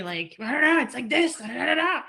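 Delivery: hammed-up rolled-R babble; mock Beijing yelling. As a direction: parody a rude Beijing voice. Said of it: "why is he yelling at me".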